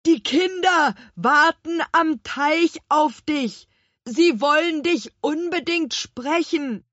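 slow, loud, high call upwards; drawn-out apple-man shout over a distance. A narrator's reading. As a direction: shout it up word by word. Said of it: "rief Gurkenhut".

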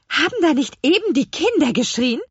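sharp-eared, lifted voice; alert questioning. Acted. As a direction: ask with sudden alarm. Witch alarmed at distant screams.

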